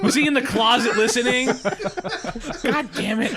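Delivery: high, loud, laughing mock outrage; amused, exasperated outburst; laughing exasperation. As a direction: protest through laughter, pitched well up.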